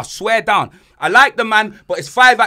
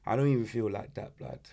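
low, soft, listless mumble; can't be bothered; 9 semitones lower, 13 dB quieter, faster than usual. Acted. Host fakes apathy before the intro, in a low, listless voice. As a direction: drag it out like you can't be bothered. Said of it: "Fam, I can't even be bothered".

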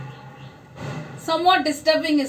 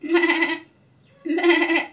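playful goat bleat with a laugh. Shown as a demonstration. Teacher bleating like a goat for the class. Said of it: "close your eyes. Just listen".

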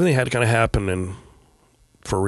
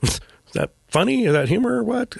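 much higher, amazed half-laughing question; incredulous laugh; 13 semitones higher than usual. Real, not acted. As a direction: marvel at the joke in disbelief. Reacting to dad's cremation joke.

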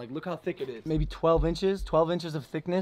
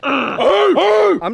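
shout; 12 semitones higher, 11 dB louder than usual. Real, not acted. Psyching up to punch pumpkin.

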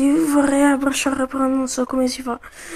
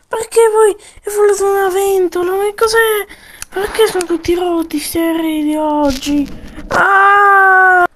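baffled outcry; 7 semitones higher, 5 dB louder than usual. Pretend. Soldier baffled by planes.

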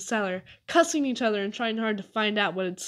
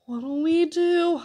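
slow, higher, worried question; gulping; 7 semitones higher, 5 dB louder, much slower than usual. A narrator's reading. Boy to his friends with tramps in the house, gulping. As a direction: drag it out in helpless fright. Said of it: "I gulped".

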